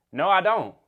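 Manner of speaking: firm, punched-up pushback; blunt refusal. Retold or imitated. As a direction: say it straight to his face. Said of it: "I told him straight to his face".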